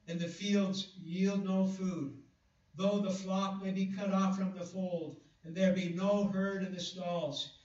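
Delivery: solemn preacher's scripture read. Acted. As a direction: read it out slowly with weight. Reads Habakkuk scripture aloud.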